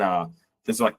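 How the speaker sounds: ordinary talk, nothing marked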